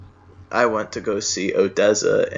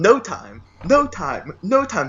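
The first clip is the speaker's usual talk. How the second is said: high-pitched hopeful repeats, rising higher; imitated eager catchphrase; 8 semitones higher, slower than usual. Man going round the circle for high fives, imitated high and eager. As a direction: keep pitching it to each person like a mock greeting.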